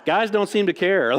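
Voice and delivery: usual speaking voice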